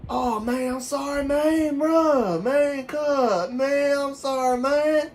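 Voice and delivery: high, loud Southern drawl of sympathy; hammed-up Southern guy commiserating; Southern US accent. Shown as a demonstration. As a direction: wail it like an exaggerated down-south buddy. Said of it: "Everybody talks like this".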